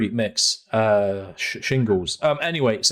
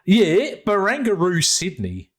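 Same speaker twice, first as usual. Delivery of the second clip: put-on Barangaroo higher in a playful Australian accent; mock Australian accent; 7 semitones higher, 4 dB louder, slower than usual. Podcaster repeating the place name to his co-host, in mock Australian accent. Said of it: "I'm gonna do this in Australian accent".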